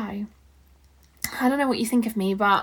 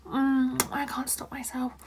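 ordinary talk, nothing marked

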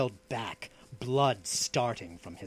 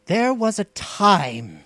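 slow, raised bitter declaration; 10 semitones higher, 11 dB louder, slower than usual. A narrator's reading. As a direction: open bitterness, loud and drawn out. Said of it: "he said with unconcealed bitterness".

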